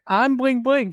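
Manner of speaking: high, amused impression of a friend; playful mimicry. Listener playfully mimics the storyteller.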